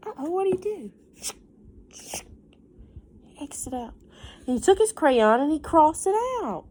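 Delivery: put-on lively storybook reading for kids; reading to children. A narrator's reading. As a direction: read it like story time for a class. Teacher reads picture book aloud.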